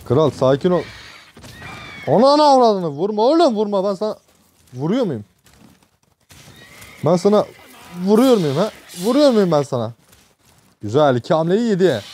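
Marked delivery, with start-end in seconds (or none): 2.1-2.9 high, loud yelled curse; exasperated yell. Real, not acted. Yelled curse as griffin hits. 2.9-9.9 high, exasperated pleading at the monster; frantic protest. Real, not acted. Yelling at griffin in game.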